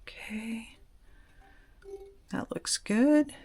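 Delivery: breathy whisper while concentrating. Real, not acted. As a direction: whisper it, barely voiced. Whispering while pouring wax.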